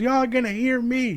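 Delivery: slow, high, loud mock holler; imitated defiant shout. Mother demanding the crowd hear her, mock drawn-out shout. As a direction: stretch it out as a defiant yell.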